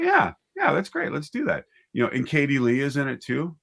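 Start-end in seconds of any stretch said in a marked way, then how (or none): 0.0-1.7 much higher, bursting yes that settles quickly; eager approval. Retold or imitated. Director recalling his delight at an actor's idea, excited. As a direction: cheer the idea on like a thrilled director.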